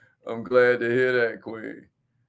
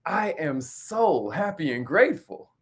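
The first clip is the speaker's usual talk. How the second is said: affirmation, said like you mean it. Shown as a demonstration. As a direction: lead the group in a heartfelt affirmation. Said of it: "Say it like you mean it".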